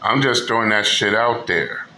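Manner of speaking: ordinary talk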